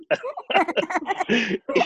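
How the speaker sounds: squealing laugh; high laugh. Real, not acted. Laughing at joke.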